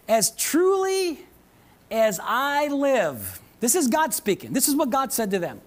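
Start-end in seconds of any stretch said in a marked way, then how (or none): 0.1-3.4 very slow, drawn out, pitched up on truly; God's oath, stretched slow and grave; solemn divine oath. Acted. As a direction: lay out God's words like a slow vow.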